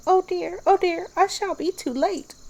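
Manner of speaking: high, anxious little rabbit fussing; fretting rabbit voice. A narrator's reading. Rabbit frets it will be late.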